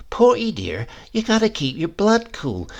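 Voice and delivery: coaxing sweetheart. Acted. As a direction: coax him like a sly sweetheart. Vicky coaxing Porky.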